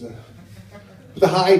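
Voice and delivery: ordinary talk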